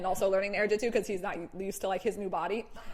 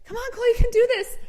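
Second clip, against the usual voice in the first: high, loud call up the mountain; encouraging yell; 12 semitones higher, 5 dB louder than usual. Retold or imitated. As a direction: cheer him on from below. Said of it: "like, yelling at him from down".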